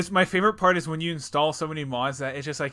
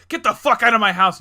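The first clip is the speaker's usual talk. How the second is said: loud, raised-pitch outraged shout; acted angry yell; 7 semitones higher, 12 dB louder than usual. Sleeping game character to intruding player, mock angry shout.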